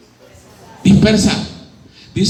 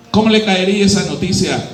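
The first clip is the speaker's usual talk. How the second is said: ordinary delivery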